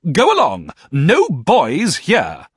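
put-on high, loud, slow shout of a fierce old lady; stern old aunt. A narrator's reading. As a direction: drive the boy off with a shrill bark. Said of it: "said Miss Betsy".

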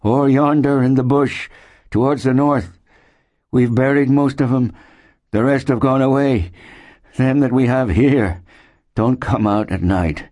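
high-pitched, urgent character voice; grim, ailing settler. A narrator's reading. Settler warns newcomers about the bush, high and grimly urgent. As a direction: warn the newcomers of death around them.

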